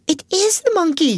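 high excited cry; a girl spotting the monkey; cried out. A narrator's reading. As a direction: call it out in delight. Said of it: "she cried out".

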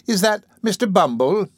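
high, sugary sweet question; coy matron, sweetly and lightly voiced; much sweeter tone. A narrator's reading. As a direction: sweeten up for the beadle. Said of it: "in a much sweeter tone".

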